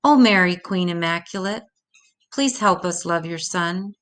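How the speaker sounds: ordinary delivery, nothing marked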